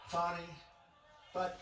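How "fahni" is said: "Fani" alone as FAH-nee, open first vowel; French-style pronunciation. Shown as a demonstration. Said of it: "a little French accent there".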